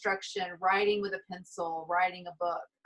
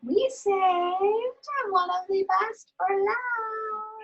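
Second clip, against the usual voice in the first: high, slow drawn-out build-up; excited high announcing; 13 semitones higher, slower than usual. Real, not acted. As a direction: announce it like a big reveal. Announcing the best card.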